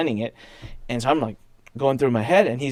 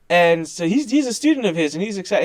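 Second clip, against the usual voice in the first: ordinary talk, nothing marked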